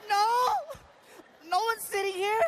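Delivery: acted high-voiced alarm; high-pitched protest. Acted panicked reply in a story.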